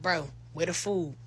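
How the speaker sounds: low, soft, slow let-down question; low soft disappointed question; deflated ask. Retold or imitated. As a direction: ask flatly, defeated. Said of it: "I'm like".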